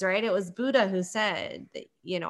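ordinary delivery

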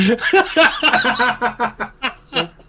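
loud, high, booming evil laugh; evil demon laugh. Acted. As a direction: cackle like a gloating demon. Demon laughs after threat.

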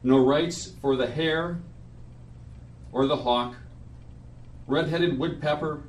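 measured poetry reading at a memorial; solemn reading. Acted. Reads his friend's poem aloud.

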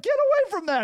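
high, sharp command to the dog; scolding. Retold or imitated. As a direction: shoo the dog off. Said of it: "And you're like".

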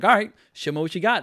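loud, high, eager dare; eager challenge. Retold or imitated. To accent-trying foreigners, a loud eager dare. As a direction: egg them on, game for it. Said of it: "I'm like".

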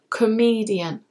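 Comedian stressed on ME; stress on middle syllable. Shown as a demonstration. Teacher models comedian for learners, middle syllable stressed. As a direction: lean on ME in Comedian. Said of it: "the stress is on the middle syllable".

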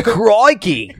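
high, hammed-up Aussie "Crikey"; mock Australian accent. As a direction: fake Aussie accent, overdone.

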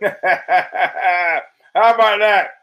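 real laugh through the words, stretched out slowly; cracking up while repeating the jab. Real, not acted. Repeating the fighter's jab to the listeners, laughing.

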